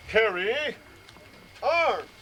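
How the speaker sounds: loud drawn-out order ending high and clipped; drill command. Shown as a demonstration. Instructor returning troops to carry, a loud drill call. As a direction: sing it out like a drill sergeant.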